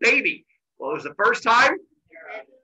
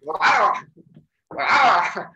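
loud, high, drawn-out "wow"; pained yelp; 4 semitones higher, 6 dB louder than usual. Retold or imitated. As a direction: imitate a jolt from a kick. Leg baby kicks while walking.

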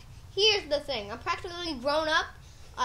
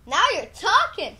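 louder, thrilled agreement; excited; 3 semitones higher, 8 dB louder than usual. Acted. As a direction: jump on the idea eagerly. Excited son hears good news.

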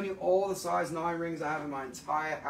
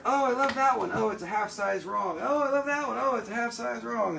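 higher-pitched mock gushing customers; imitated customers; 6 semitones higher than usual. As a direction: mimic customers swinging from delight to letdown, higher. Imitating customers trying on rings.

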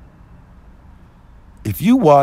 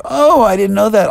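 raised, louder surprised reply; surprised realization; 8 semitones higher, 5 dB louder than usual. Retold or imitated. Telling how she answered the employee, surprised realization. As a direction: act out learning something new, voice jumping up.

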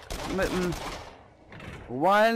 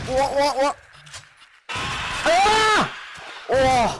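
startled cries; 6 semitones higher, 4 dB louder, slower than usual. Real, not acted. Scared on horror ride.

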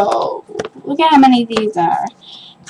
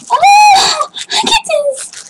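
high squeal of delight, drawn out; shrieking with joy at the gift; slower than usual. Real, not acted. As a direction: squeal at the new squishy. Unboxing surprise.

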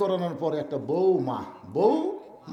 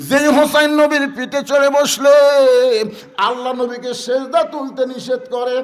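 loud, high shouted sermon; fervent shouted waz delivery; preaching at full force; 8 semitones higher, 12 dB louder than usual. Acted. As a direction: cry it out to the congregation.

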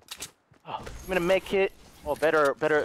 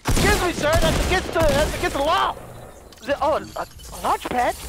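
indignant shout; 5 semitones higher, 3 dB louder than usual. Real, not acted. Indignant at enemy breaking in.

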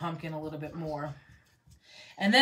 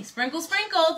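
high playful chant, louder; playful high sing-song; sing-song sprinkle; 10 semitones higher, 5 dB louder than usual. Real, not acted. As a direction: chirp it out like a little rhyme.

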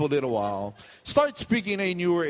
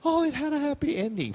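mock cheerful quote; 12 semitones higher than usual. Mocking what everybody says.

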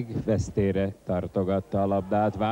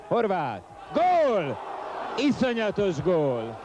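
very high excited goal shout; excited hungarian sports commentary; 8 semitones higher, slower than usual. Real, not acted. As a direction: scream the goal in delight.

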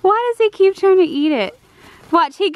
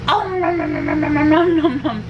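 pretend eating; faster than usual. Pretend eating baby's food.

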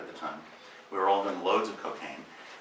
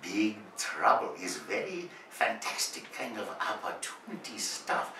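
put-on soft Japanese accent; 4 semitones lower, 6 dB quieter, slower than usual. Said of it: "before continuing".

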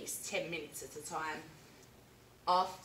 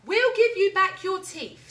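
much higher, louder frog voice; Frog's offer; 11 semitones higher, 13 dB louder than usual. Acted. Frog offers Alan his teeth back, high and loud. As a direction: chirp the offer brightly. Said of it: "said Frog".

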